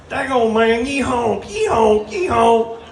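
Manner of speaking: high, hammed-up Southern drawl for a goose; goose southern accent. Comic imagining Canadian geese, in a mock Southern accent. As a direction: play a hillbilly goose honking. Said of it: "have real bad southern accents".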